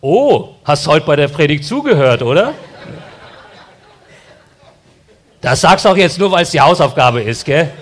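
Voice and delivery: loud, mocking, half-laughing jab; snide reply. Church member mocking a loving compliment, loud and snide. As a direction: needle the compliment-giver with a laugh. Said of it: "dann bitte nicht folgendermaßen antworten".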